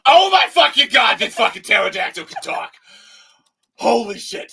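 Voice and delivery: high, loud freaked-out yelling; shocked shout. Pretend. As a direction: yell in mock horror. Mock shock at talking dinosaur.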